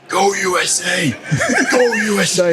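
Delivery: chanting it like a rowdy supporter; retold scream. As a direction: imitate a screaming fan without full volume. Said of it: "this bloke starts screaming at me".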